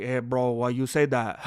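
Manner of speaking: low, blunt broken-English accent; deep, broken-accent imitation; caveman-like broken accent. Shown as a demonstration. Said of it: "little caveman kind of aspect to it in that broken accent".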